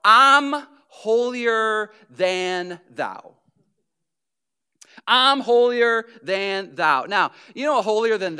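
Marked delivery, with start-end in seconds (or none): 0.0-3.3 slow, drawn-out smug sneer; a smug, drawn-out holier-than-thou. Retold or imitated. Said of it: "what I call". 5.0-7.0 smug and a bit louder; self-righteous repeat; holier than thou. Retold or imitated. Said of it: "what I call".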